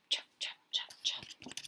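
quiet whispered chop; soft whispered footsteps. A narrator's reading. Father's soft shoes on the path, whispered. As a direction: whisper each chop gently. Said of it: "They make a soft sound".

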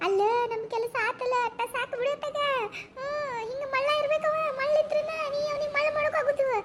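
high-pitched scheming glee; sly, high gloating; gloating mockery. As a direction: scoff at the dupe, voice pitched high. Gloating over the gullible man.

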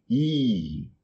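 "I" held long. Shown as a demonstration. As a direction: stretch the vowel "I". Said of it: "É um I longo". ("I" longo)

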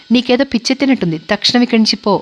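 usual speaking voice

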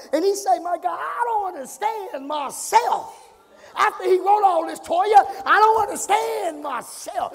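high, driving pulpit delivery; full-force preaching. Real, not acted. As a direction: preach it hard, building into shouts.

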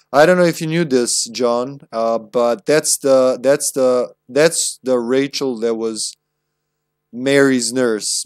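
normal talking voice

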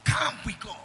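calls it out loud to the hall; rally the room with it; a loud preacher's call. Real, not acted.

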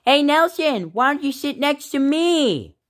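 put-on squeaky, high little boy; high kid voice. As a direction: sweet-talk the new kid. Little Johnny calls new kid.

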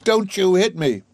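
high, loud cry of alarm, cowering; frightened exclamation. A narrator's reading. Eliza crouching at the piano, crying out in alarm. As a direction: shrink away and cry out.

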